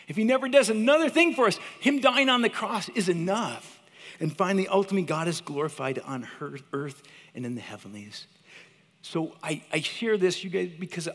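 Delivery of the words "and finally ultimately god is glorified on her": ordinary delivery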